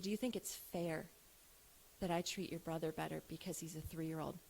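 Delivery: quiet, low, gentle question; soft questioning. Retold or imitated. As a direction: ask softly so he thinks it over. Mother softly asking son.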